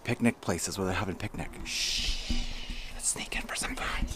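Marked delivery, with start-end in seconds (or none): 1.6-4.0 sneaky hushed whisper. Pretend. Sneaking up on a picnic.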